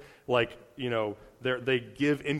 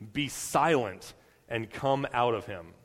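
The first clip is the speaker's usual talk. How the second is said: ordinary talk, nothing marked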